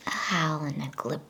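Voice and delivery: ordinary delivery, nothing marked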